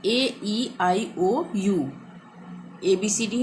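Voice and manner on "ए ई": ordinary talk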